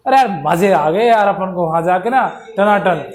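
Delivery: normal talking voice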